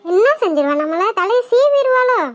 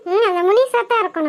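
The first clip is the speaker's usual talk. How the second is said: ordinary talk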